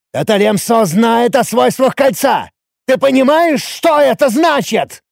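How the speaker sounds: high, agitated shouting; раздражённый крик. A narrator's reading. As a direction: press the point urgently at a shout. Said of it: "раздражённо взревел я". (взвинченно, на крике)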